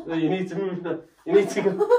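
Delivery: instructions broken up by giggles; helpless real laughter while directing; laughing. Real, not acted. Partner coaching a stunt, unable to stop laughing. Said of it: "Stop laughing".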